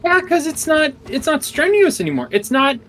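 high-pitched whine. Acted. Mock whine about game.

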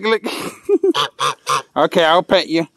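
ordinary talk

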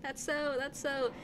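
put-on softened Valley Girl lilt. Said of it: "in a Valley Girl voice".

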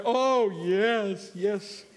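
high, tearful mock wail; imitated crying. Lady asked to pray, imitated weeping assent. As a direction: answer as if blubbering through tears. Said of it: "Just crying the whole way through".

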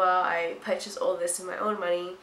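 ordinary talk, nothing marked

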